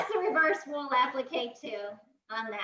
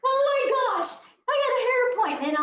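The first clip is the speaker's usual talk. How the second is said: panicked; 9 semitones higher, 4 dB louder, faster than usual. Retold or imitated. Panics about missing hair appointment.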